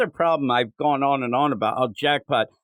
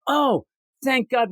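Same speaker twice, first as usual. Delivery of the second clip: high, drawn-out relieved exclamation; mock relief; 9 semitones higher, slower than usual. [name] hears MJ will quit, theatrically relieved. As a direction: heave out slow, exaggerated thanks.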